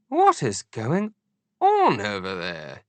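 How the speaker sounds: slow lilting royal voice; Queen's voice. A narrator's reading. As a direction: speak as the queen, puzzled. Queen on phone.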